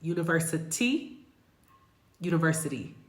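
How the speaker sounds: university with the T as a quick D, univer-si-dee; tapping the T in university like a fast D for students; flap T demo. Shown as a demonstration. Teacher modeling university with a flapped T. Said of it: "You hear that?".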